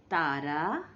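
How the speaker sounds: ordinary delivery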